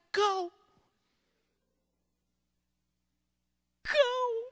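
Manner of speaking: high, quavering mock plea; tearful little girl. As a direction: send it away softly through pretend tears. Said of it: "And she's crying".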